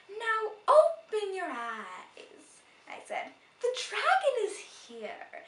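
loud, slow grand reveal; dramatic reveal. Acted. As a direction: unveil the dragon with wonder.

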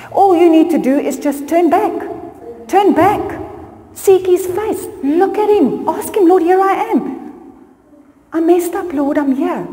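high, pleading preacher's call; impassioned plea. Real, not acted. Preacher urging the congregation to turn back to God, high impassioned plea.

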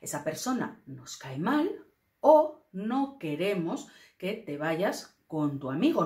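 ordinary talk

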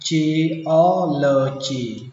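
"geology" stretched very slowly, jee-OL-o-gee; slow model reading. Shown as a demonstration. Teacher reads "geology" very slowly so students can follow. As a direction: drag out "geology" syllable by syllable. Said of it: "đọc là".

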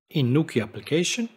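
normal talking voice

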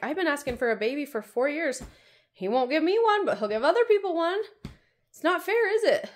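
put-on high, loud childish complaint; mock whine. Mother echoing her son's complaint in a high, loud mock whine. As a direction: whine it like a kid denied a toy. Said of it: "I'm like".

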